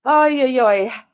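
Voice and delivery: loud, high groan of despair; exasperated ay ay ay. Real, not acted. Worn out from the story, she lets out ay ay ay. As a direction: let out a fed-up ay ay ay.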